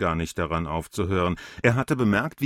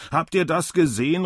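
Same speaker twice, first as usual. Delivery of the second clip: higher, calling out to the crowd; 8 semitones higher than usual. A narrator's reading. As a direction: call to the bystanders, mildly raised. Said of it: "rief der Alte den Umstehenden zu". (den Umstehenden zurufend, leicht erhoben)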